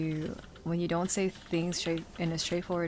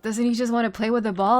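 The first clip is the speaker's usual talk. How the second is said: loud, high disbelief; mock bewildered protest; louder, higher, baffled; incredulous; 4 semitones higher, 10 dB louder than usual. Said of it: "I was like".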